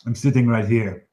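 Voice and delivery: a hint of a loud, gruff challenge; lightly voiced warrior calling out the bard; challenging taunt. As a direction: toss the dare across the hall. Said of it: "he says".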